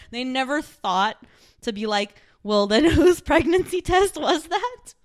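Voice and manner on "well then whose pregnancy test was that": high, loud, laughing mock realization; mock dawning surprise. Mocking the clueless couple's sudden realization. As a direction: play the dumb couple finally getting it.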